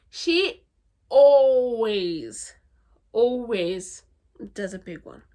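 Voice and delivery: loud, stretched emphatic always; emphatic praise. Real, not acted. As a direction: punch the always hard.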